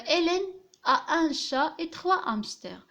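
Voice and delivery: slow dictation reading. Shown as a demonstration. Teacher reads example sentence.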